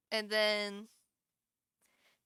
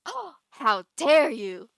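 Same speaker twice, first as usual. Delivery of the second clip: a slow, much louder hammed-up gasp of outrage; mock appalled; 3 semitones higher, 9 dB louder, slower than usual. Streamer acting out her appalled emote, slow loud mock outrage. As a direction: play the scandalised aristocrat, half-joking. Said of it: "being, like, appalled".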